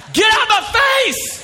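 very high-pitched comic yell; frantic shout. Retold or imitated. Dad barging past nurses for daughter, frantic high shout. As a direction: yell it in an over-the-top frenzy.

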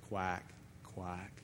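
low mocking quack, quack; duck quacks. Preacher voicing the devil as a duck, low mocking quack.

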